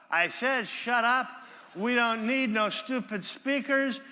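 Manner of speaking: put-on high, loud, slow drunken heckler; heckler shout. Speaker imitating the heckler shouting again, loud. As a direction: yell it like a rowdy drunk in the back. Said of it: "he shouted out".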